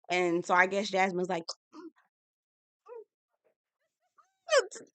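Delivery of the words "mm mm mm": tiny high stifled moans; hushed high mm sounds; mock muffled moans. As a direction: imitate stifled moaning, very soft. Mimics wife's muffled moaning.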